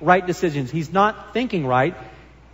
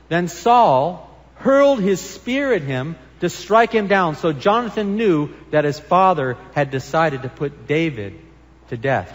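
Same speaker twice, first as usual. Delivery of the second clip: ordinary talk, nothing marked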